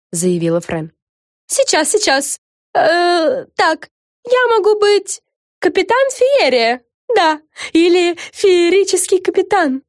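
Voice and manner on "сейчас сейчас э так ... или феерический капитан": high, slow, deliberating character voice; self-important Fren, pondering. Acted. Fren invents herself a sea name, pondering. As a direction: think aloud grandly, high and drawn out.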